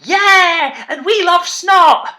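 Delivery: mock the tiny kids shouting happily; little kids cheering. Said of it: "the little kids down the front were going".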